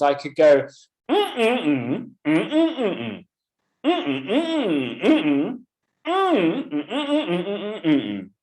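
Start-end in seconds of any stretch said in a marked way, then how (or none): 1.1-8.3 wordless hum with rising and falling contours; humming speech intonation. Shown as a demonstration. Teacher models the speech melody for students, humming. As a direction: hum each phrase as a pause group, no words. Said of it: "remove the words and to just hum".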